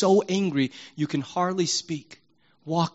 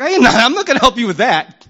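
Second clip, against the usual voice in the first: high, loud and fast, laughing through it; playful God voice; 8 semitones higher, 11 dB louder, faster than usual. Retold or imitated. Preacher voicing God turning down the oath, high, loud, laughing. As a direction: answer as God, amused and refusing. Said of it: "And God's like".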